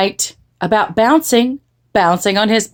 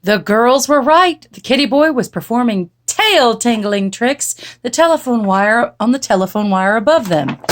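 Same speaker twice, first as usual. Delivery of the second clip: ordinary delivery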